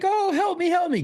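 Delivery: very high mock-helpless cry; put-on squeaky distress; imitated plea for help. Pleading to the guard before grabbing the gun, mock distress.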